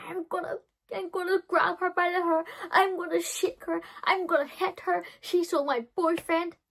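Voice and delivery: high, loud, hammed-up teenage rage; mock angry teen. Jealous teen rants to the roommates, high and loud.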